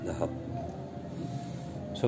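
normal talking voice

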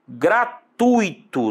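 "gratuito" very slow, gra-TUI-to; deliberate "gratuito", ui held in one syllable; correct diphthong. Shown as a demonstration. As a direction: stretch "gratuito" with the stress on the u. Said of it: "não vá pronunciar gratuito".

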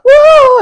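high, loud excited whoop; excited woo. Real, not acted. She shows viewers her excitement with a loud woo. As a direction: let out a joyful woo. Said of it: "You can tell I'm like".

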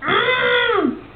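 a high, drawn-out mm like an elephant; mock elephant call; elephant hum. Shown as a demonstration. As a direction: hum the animal noise for the child. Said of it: "It goes".

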